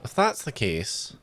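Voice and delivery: tired, inconsistent mock Scottish accent; bad Scottish accent. Shown as a demonstration. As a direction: attempt Scottish and let it slip in and out. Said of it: "five minutes of a bad Scottish accent".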